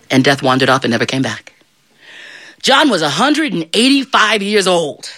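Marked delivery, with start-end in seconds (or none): none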